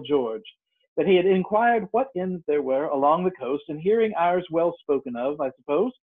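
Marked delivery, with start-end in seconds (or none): none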